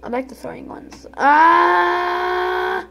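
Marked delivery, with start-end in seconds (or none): 1.1-2.9 high, loud sudden shout; startled shout. Real, not acted. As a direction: yell in surprise. Startled yell.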